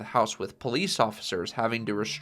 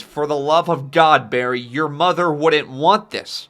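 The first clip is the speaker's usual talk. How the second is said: loud, slow, raised protest; loud exasperation; 4 semitones higher, 9 dB louder, slower than usual. Acted. Henry arguing with Barry, loud exasperated outburst. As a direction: raise your voice and stretch the words. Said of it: "they've been getting loud towards each other".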